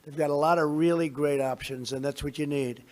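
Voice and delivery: normal talking voice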